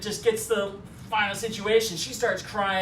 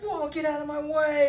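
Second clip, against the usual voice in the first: higher-pitched startled bystander; higher, hammed-up warning; mock alarm; 6 semitones higher than usual. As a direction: act out a panicked passer-by without raising the volume. Startled crowd in retold chase.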